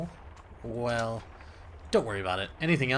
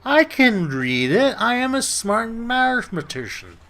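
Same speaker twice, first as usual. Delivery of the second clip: loud, high, slow indignant claim; indignant boast; 11 semitones higher, 10 dB louder than usual. Real, not acted. Gamer protesting to the librarian, loud indignant boast. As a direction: insist you're smart.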